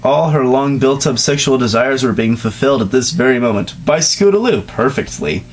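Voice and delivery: ordinary delivery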